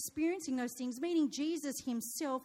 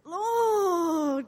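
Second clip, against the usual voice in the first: mock exasperation; 5 semitones higher, 8 dB louder than usual. Exasperated mother's retold plea.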